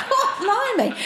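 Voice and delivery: high laughing exclamation; cracking up at her own joke. Real, not acted. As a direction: burst out laughing.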